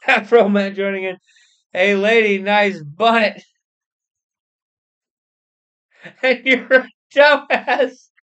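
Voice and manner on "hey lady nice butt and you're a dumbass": loud, high, slow catcall. Acted. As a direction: holler at her across the street. Afro Man catcalling passerby.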